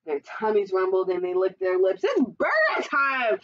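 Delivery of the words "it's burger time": high, drawn-out gleeful call; slow, high, eager cheer; excited boys' cry. A narrator's reading. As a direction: announce the feast with relish. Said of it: "they cried".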